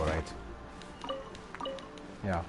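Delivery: ordinary talk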